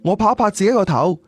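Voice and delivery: plain reading voice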